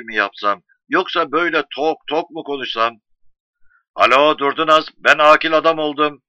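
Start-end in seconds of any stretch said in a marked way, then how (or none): 3.9-6.2 projected, proud hello to the wife; loud phone announcement. Acted. Husband phoning his wife with his grand news, loudly proud. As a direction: announce big news down the line.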